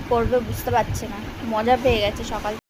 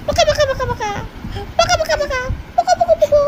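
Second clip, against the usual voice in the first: high squeaky sing-song "পকা পকা" rising ever higher; 12 semitones higher than usual. Real, not acted. Playing with baby, cooing sounds. (বাচ্চার সঙ্গে খেলতে খেলতে উঁচু গলায় আদুরে ডাক)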